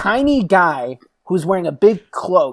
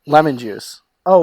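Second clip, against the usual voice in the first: ordinary delivery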